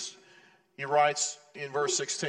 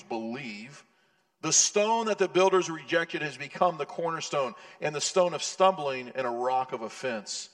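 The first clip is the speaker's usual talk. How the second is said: scripture reading; 5 dB louder than usual. Acted. Reads scripture in full voice.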